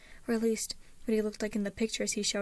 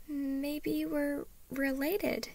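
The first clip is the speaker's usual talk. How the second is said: slow, drawn-out and higher; tentative; 5 semitones higher, much slower than usual. A narrator's reading. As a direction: venture the idea uncertainly. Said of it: "Harriet tried".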